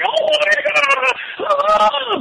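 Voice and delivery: very high, drawn-out fanboy squeal; squealed, stretched-out outburst of wrestling excitement; ecstatic fan squeal. Retold or imitated. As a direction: re-enact popping with a long, high-pitched cry. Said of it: "I was like".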